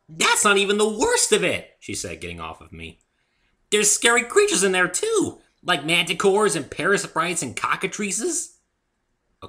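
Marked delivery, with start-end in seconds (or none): 0.1-1.8 high, loud, bouncy pony voice; high loud cheerful pony girl; bubbly Pinkie. A narrator's reading. 3.7-8.6 high, loud, bouncy pony voice; high loud cheerful pony girl; bubbly Pinkie. A narrator's reading.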